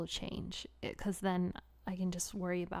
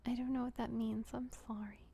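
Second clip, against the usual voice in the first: normal talking voice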